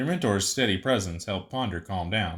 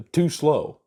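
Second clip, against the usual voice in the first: alarmed shout; 6 semitones higher, 6 dB louder than usual. Acted. Failing to catch target.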